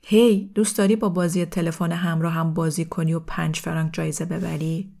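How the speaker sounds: plain reading voice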